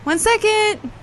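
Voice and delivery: high, loud call toward the door. Acted. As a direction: shout it through the door, impatient.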